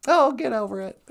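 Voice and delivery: high puppet scoffing. Acted. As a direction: brush it off as a sassy puppet. Puppet sidekick answers host.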